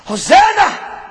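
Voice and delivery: high, loud shouted Hosanna; children crying out Hosanna. Acted. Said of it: "they're crying".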